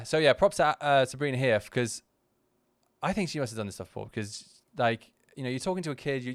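usual speaking voice

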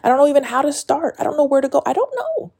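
high, fast, pleading; anxious outpouring. Real, not acted. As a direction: spill out the worry. Anxious, rising, fast confession.

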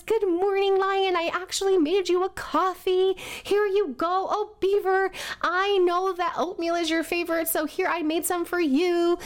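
high, bubbly eager greeting; chirpy and high, so happy to see everyone; excited. Retold or imitated. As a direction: gush over your friends like an eager puppy. Excited golden retriever greeting friends.

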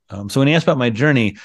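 usual speaking voice

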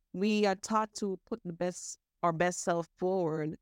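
usual speaking voice

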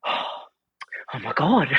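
a gasp, then a slow hushed oh my God; drawn-out awed disbelief; mock amazement, breathed out softly; stunned gasp. Woman stunned on meeting her.

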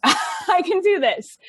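amused self-pep talk; laughing. Real, not acted. Guest laughing while quoting her own pep talk.

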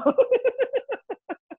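trailing high laugh; real laugh. Real, not acted. Laughs at dad's accent.

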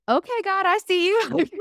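high, wide-eyed "okay, God, I see you"; catching on with a delighted gasp; awed realization. Retold or imitated. Telling a friend how she answered God, awed. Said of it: "And so that's when I was like".

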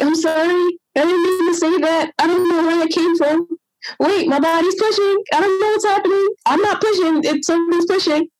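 high, panicky and breathless; panicked apology. Retold or imitated. Mother in labor tells husband, panicked and high. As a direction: blurt it out in alarm as the body takes over. Body pushing on its own.

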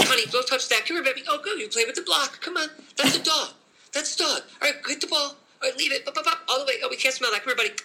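put-on high sing-song voice for a small child; a parent cooing at a three-year-old; parent talking to toddler. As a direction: coax it like chasing a toddler around. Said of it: "have you ever spent time with a three-year-old".

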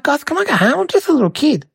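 put-on high-pitched childlike plea; pleading. Little kid stuck playing Santa begs to leave, pleading. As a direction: plead to be let off, pitched well up.

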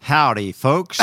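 South African's loud, high try at an American twang; American accent. Shown as a demonstration. South African guest shows his best American accent, exaggerated twang. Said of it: "do your best American accent".

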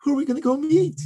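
imitating a small child, high-pitched and eager; high little-kid voice, rising at the end; little boy. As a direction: pipe up like a young son asking Mommy. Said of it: "my little son asked me".

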